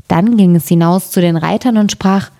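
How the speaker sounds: plain reading voice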